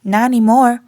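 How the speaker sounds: ordinary delivery, nothing marked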